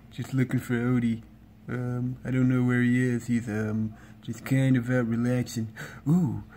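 lazy cartoon cat voice, evenly pitched; Garfield. Cat greets YouTube and talks of finding Odie, Garfield impression. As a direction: impersonate a laid-back, food-loving cat on a level tone. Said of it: "I'm gonna be doing Garfield voice impression".

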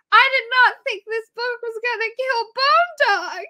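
high, slow, half-crying voice; teary disbelief. Retold or imitated. Listener retelling crying in the car, high teary wail. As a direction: relive the shock of losing him. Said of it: "I just start crying".

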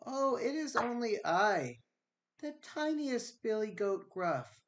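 high, soft little goat voice; timid tiny billy goat pleading with the troll. Acted. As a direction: squeak it out like the smallest goat. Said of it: "in his very small voice".

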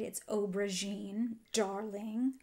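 light slow posh-lady impression; a hint of a glamorous makeup mogul; imitating Charlotte Tilbury. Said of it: "as Charlotte Tilbury calls the shade".

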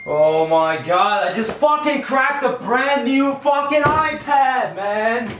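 mad. Real, not acted. Owner realizing he cracked a brand new iPad, mad. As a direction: wail in real distress and anger. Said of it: "You mad?".

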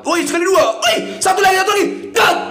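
frantic shout. Real, not acted. Begging for one more symbol.

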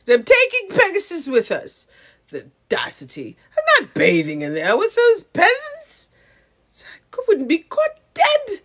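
high-pitched mock indignation; haughty colonizer character. Refusing to bathe with those peasants, mock haughty. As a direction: play a scandalized snob.

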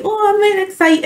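high-pitched mock-excited gush; imitated excitement. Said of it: "when I'm watching trailers, I'm like".

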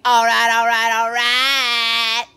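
loud, drawn-out hyped chant; loud sing-song all right; hype. Real, not acted. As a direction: hype yourself up.

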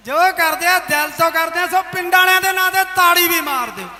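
ordinary delivery

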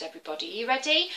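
ordinary delivery

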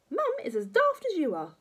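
high, loud granny jeer; scornful grandma. Acted. Grandma insulting George.

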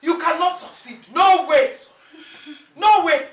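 high, loud defiant shouting; angry defiance. Acted. Mother defying her accused attacker, angry shout. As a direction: throw it at her forcefully. Mother defies daughter-in-law.

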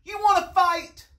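high, loud dare; pugnacious bug challenge; grouchy ladybug. A narrator's reading. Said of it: "said the grouchy ladybug".

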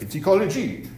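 a rough imitation of a French accent; Jacques's French accent. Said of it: "Sorry for the bad French accent".

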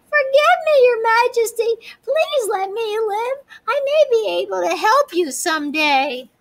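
high trembling mouse voice pleading; frightened prey animal. A narrator's reading. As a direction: beg for your life, small and scared. Mouse begs the lion.

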